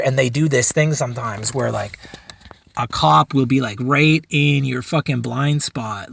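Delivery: ordinary talk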